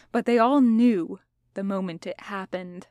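ordinary delivery

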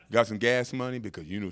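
ordinary talk

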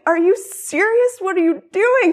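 shocked disbelief. Retold or imitated. Wife protesting to husband who phoned the pastor, high disbelief. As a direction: stretch it out in high outrage.

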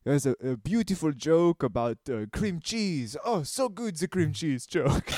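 hammed-up French connoisseur voice; put-on French accent, rising to a coo. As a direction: savour it in a mock French accent. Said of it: "My family's French. I'm allowed to do that".

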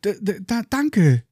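trembling voice. Acted. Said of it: "leicht zitternder Stimme".

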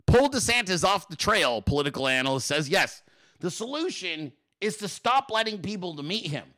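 ordinary talk

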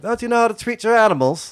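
high, loud urchin voice fawning over the dog; cheeky urchin sweet-talking a giant dog; street kid voice. Acted. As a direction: coo at the big dog like a scrappy street kid.

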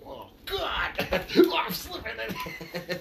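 high laughing squeal; laughing mock newborn. Joking about watching own birth, laughing high. Acting a baby being born.

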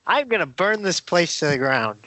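giggling through a mock villain line; half-laughing Loki threat. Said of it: "he says in the trailer".

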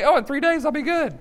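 lightly mock a too-easy recovery; mock breezy promise. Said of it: "it's not that you can say".

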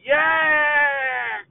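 wild shouted rocker cry, hammed up; screaming the band name to a crowd. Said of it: "And he's like".

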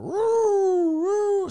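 very high loud siren wail; siren imitation. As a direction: woo like a police siren. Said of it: "sirens everywhere".

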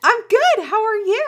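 high, loud, chirpy reply; over-cheery greeting. Real, not acted. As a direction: answer brightly, pitched well up. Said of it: "think we're, like, bullshitting".

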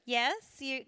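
ordinary delivery

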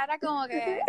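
normal talking voice

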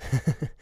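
brief laugh mid-sermon; short chuckle. Real, not acted. Preacher after saying he sowed a seed, chuckling. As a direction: chuckle briefly between phrases.

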